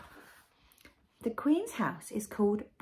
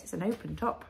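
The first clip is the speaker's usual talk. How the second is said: plain reading voice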